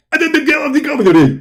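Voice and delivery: high, fast mocking gabble of the opponent's words; garbled mockery. Mocking the debater's words back at him as high, fast gibberish. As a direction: parrot his speech as rapid nonsense. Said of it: "is that a Bangladesh language or something".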